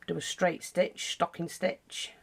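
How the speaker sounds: ordinary talk, nothing marked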